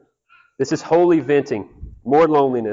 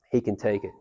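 soft echo; 6 semitones lower, 8 dB quieter than usual. Shown as a demonstration. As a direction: lower and softer, settling reassurance. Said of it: "tell him".

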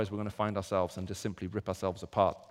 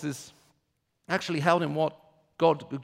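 ordinary talk

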